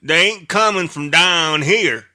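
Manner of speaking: put-on mild Southern good ol' boy; Southern accent. Answering in Southern character.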